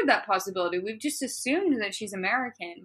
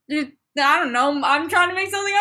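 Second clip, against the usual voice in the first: ordinary delivery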